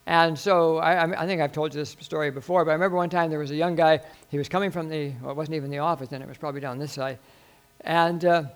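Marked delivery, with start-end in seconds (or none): none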